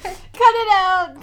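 loud, slow cut it out; telling a friend to stop, loud and slow; loud slow scolding. Real, not acted. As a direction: stretch it out to stop the joke.

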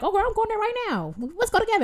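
very high, eager invitation; high-pitched impression of an overeager tagalong; imitated eager stranger. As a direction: mimic her pushy enthusiasm in a high voice. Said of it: "She's like".

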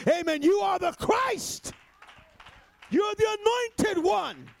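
sermon climb, slow and high; exalted preaching. Acted. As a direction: lift it up slowly like a declaration. Preacher repeats confession, exalted.

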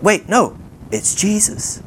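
high-pitched double take; retold startled realisation. Disciples recognise Jesus on the water, acted surprise. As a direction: catch yourself, pitch up in recognition. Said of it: "they're freaking out".

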